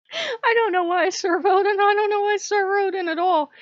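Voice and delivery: high, loud and exasperated; loud exasperation. Real, not acted. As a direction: burst out at your own confusion. Bursts out laughing at himself.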